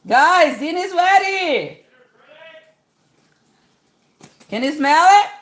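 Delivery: loud call across the house; yelling across the room. Real, not acted. Calling kids to dinner.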